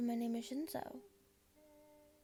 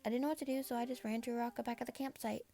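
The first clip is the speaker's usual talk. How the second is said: ordinary delivery, nothing marked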